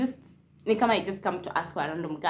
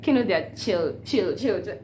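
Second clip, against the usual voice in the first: usual speaking voice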